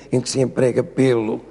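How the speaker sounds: ordinary talk, nothing marked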